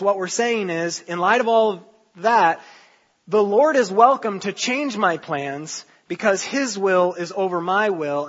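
ordinary talk, nothing marked

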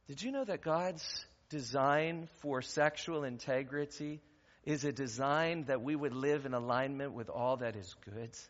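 ordinary talk, nothing marked

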